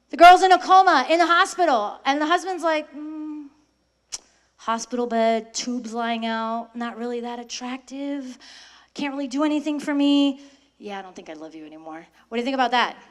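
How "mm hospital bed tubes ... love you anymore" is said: cold husband. Acted. Husband voiced in a story.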